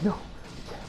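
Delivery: breathy unvoiced whisper; a hushed, voiceless no; mock whisper. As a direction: whisper it like a scared girl. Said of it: "she's like".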